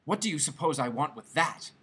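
usual speaking voice

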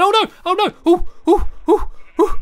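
high, loud panicked yelps; real fright in short high hoots; terrified. Real, not acted. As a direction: panic as if plunging to your death. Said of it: "That was terrifying!".

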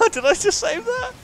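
very high, loud squeal of amazement; high-pitched incredulous cry; amazed disbelief. Real, not acted. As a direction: squeak it out, stunned by your own save. Amazed at his own save.

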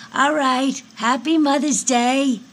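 high, loud holiday farewell; loud sign-off. Acted. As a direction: call it out high and loud to end the call. Mother calls out farewell greeting.

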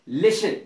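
लिसन said as "lissen", no T; silent T. Shown as a demonstration. Teacher repeating the example word, लिसन with silent T. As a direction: keep the T out of लिसन. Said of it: "तो टी silent रहता है".

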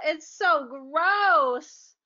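drawn-out gross-out whine; slow disgusted whine. Acted. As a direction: stretch it, utterly grossed out. Child overcome by smell.

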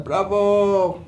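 normal talking voice

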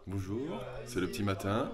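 quiet early-morning murmur; hushed morning. Real, not acted. Vlogger at dawn while others sleep, hushed.

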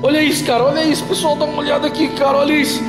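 excited shouting. Real, not acted. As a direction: freak out at what you see. Sudden paranormal event, excited. (empolgado e assustado, gritando)

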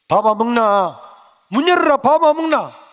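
loud, high shouts at the door; mother yelling at a locked door to open up; calling out. Retold or imitated. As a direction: bang on the door with your voice.

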